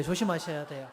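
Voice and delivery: ordinary talk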